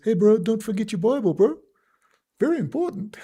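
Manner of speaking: put-on friendly Maori cop reminder in a Maori accent. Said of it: "talking in their quiet accent".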